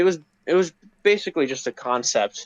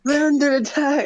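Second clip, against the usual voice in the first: much higher, lightly put-on Scottish sea captain; mock Scottish fisherman; 11 semitones higher than usual. Replaying his old fisherman line, mild mock Scottish. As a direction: toss off the old fisher line in a half-hearted Scottish accent. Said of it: "that was like a Scottish accent".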